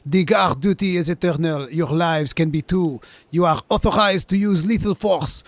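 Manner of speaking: put-on loud, high French-accented battle orders. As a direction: bellow it like a hammed-up French officer. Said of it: "spoken English with a French accent".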